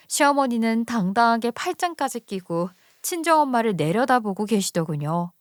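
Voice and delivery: plain reading voice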